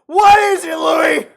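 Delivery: high, very loud call across the room; shouting out. Real, not acted. Streamer yelling at friend Louis for the answer, full shout. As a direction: yell his name to get an answer.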